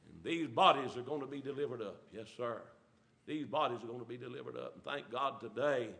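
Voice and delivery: soft, low, intimate; quiet earnest preaching; hushed preaching. Acted. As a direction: drop the voice to a near hush. Preacher drops to a hush.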